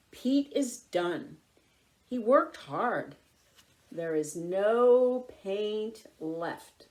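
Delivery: ordinary delivery, nothing marked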